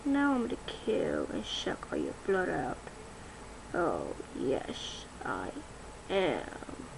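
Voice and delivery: low, soft, menacing whisper; vampire whisper. Acted. Vampire threatening the kids, whispered menace.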